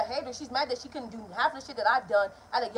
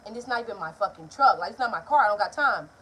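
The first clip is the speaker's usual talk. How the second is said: ordinary talk, nothing marked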